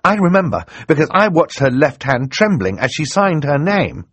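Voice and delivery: put-on high, loud, eager boy's voice; excited. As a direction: rush out the evidence excitedly. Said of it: "Klaus said excitedly".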